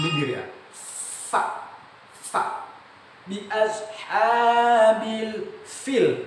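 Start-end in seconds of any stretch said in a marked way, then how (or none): 1.3-2.6 FA with a long airy f; breath on the f. Shown as a demonstration. Teacher showing air leaving the lips, breathy f in fa. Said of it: "nafas ataupun angin". 3.2-6.3 chanted high and very slow, FIL with airy f; correct recitation. Shown as a demonstration. Teacher contrasting fil with pil, correct chanted recitation. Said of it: "bukan pil".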